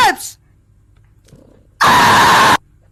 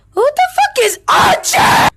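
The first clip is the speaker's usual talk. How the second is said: normal talking voice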